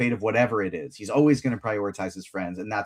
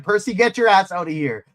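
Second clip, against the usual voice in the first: high, louder mock yell; imitated yelling; 7 semitones higher, 5 dB louder than usual. Host quoting Annabeth warning a hidden Percy, mock yelling. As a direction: call out urgently as if to someone hiding. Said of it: "but yelling very loud".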